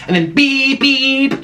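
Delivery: high mock horn beep; high imitated honk; car horn beeps.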